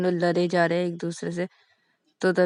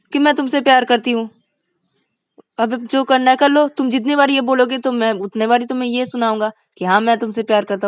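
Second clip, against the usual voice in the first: raised, stubborn retort; defiant arguing; 4 semitones higher, 6 dB louder than usual. Acted. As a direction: keep challenging her. Vikas argues defiantly. (ज़िद में बहस)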